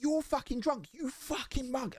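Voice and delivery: retold angry yell. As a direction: yell it in a rage, pitch jumping up. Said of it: "I lost my shit".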